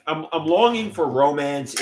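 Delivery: ordinary delivery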